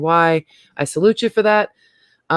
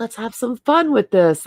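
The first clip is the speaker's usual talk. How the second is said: slow, higher put-on playful voice; playful quote; 5 semitones higher, slower than usual. Cat owner inviting fun, slow playful higher voice. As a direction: tease it out slowly like a cheeky invitation. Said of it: "there's little things like".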